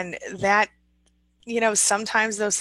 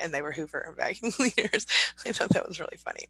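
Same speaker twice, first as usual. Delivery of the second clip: ordinary talk, nothing marked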